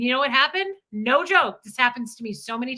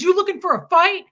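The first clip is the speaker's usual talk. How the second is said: higher, louder mock challenge; mocking tough guy; 8 semitones higher, 7 dB louder than usual. Wife mimics the neighbor daring her to fight. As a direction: puff up like a bully picking a fight. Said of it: "he goes".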